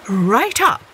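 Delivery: ordinary delivery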